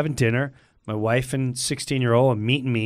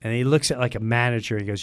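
ordinary talk, nothing marked

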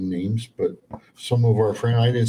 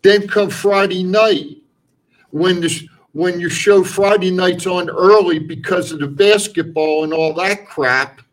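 higher and louder as he goes; building rant; 6 semitones higher, 7 dB louder than usual. Acted. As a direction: build the complaint into a tirade.

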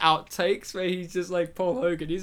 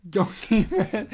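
laughing; 3 dB louder, slower than usual. Real, not acted. Laughing at the Donkey Man request.

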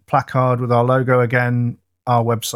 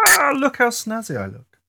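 much higher, preening voice; posh dandy; 12 semitones higher than usual. Pretend. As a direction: show off a snazzy outfit. Man in a cravat, jokey character.

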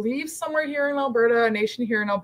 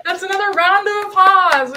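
normal talking voice

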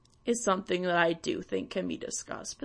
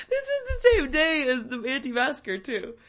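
laughing; 7 semitones higher, 8 dB louder than usual. Real, not acted. Still laughing through words.

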